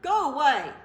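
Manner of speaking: loud, curt dismissal; bear yelling. Acted. Bear shoos the mouse from his door, loud and curt. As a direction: shout it to shoo him away.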